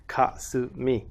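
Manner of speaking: kasumi slowly split "ka-su-mi", even beats; Japanese syllable by syllable. Shown as a demonstration. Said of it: "dual syllabic tones".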